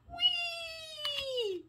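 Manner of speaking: high gliding falling whee. Acted. Grandma showing the rabbit's fall with a high whee. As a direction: slide down like a parachute ride.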